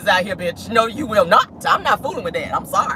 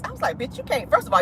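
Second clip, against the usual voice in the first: ordinary delivery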